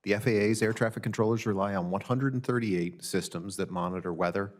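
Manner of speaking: usual speaking voice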